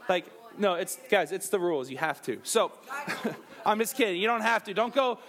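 ordinary talk

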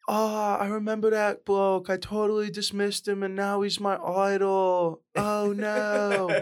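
high, drawn-out mock English accent; terrible English accent. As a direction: put on a bad, high-pitched English accent. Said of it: "is that terrible English accent supposed to be me".

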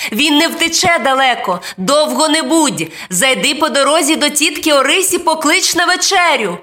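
high-pitched mom voice; mother calling instructions. A narrator's reading. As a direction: send the child off with brisk instructions. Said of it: "гукнула мама".

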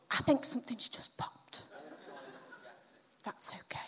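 real unvoiced whisper; whispered aside. Real, not acted. Speaker noticing something popped mid-talk, whispered aside. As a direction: drop to a whisper for a private aside.

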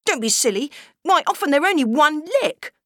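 put-on high, scornful boy; contemptuous. Brother dismissing his sister, scornful. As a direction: scoff at her like an indignant boy. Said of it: "John went rigid with contempt".

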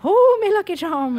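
over-the-top leprechaun voice, high; hammed-up stage Irish brogue; mock Irish accent. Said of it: "he sounded like a leprechaun".

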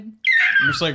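high screeching dinosaur cry; pterodactyl shriek. Acted. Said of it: "That was impressive".

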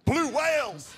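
high, loud exclamation; excited storytelling. A narrator's reading. Preacher topping the list of the catch, excited storytelling.

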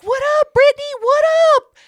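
high, loud, hollered hello; calling out a greeting. Retold or imitated. Speaker hailing the niece on arrival, calling out. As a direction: whoop a greeting across the room.

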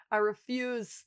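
insisting again to the co-host, quietly. Real, not acted. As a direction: repeat it under the breath. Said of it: "I refuse to let them".

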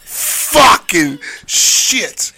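very slow, drawn-out exasperated curse; exasperated groan. Real, not acted. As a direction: drag it out in despair. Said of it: "I really wanna go to jail".

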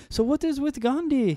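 high, hammed-up Indian accent. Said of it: "That is the best Indian accent you shall hear".

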